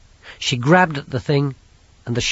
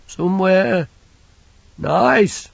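put-on high, slow, childlike big lad; simple lad voice; 8 semitones higher, 5 dB louder, slower than usual. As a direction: voice him like a big simple boy. Banjo's voice.

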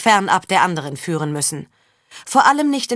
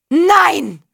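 high, loud cry of refusal; anguished scream; 10 semitones higher, 6 dB louder than usual. A narrator's reading. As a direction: scream it out in disbelief. Mother screams denial of son's death. (gellender, verzweifelter Schrei)

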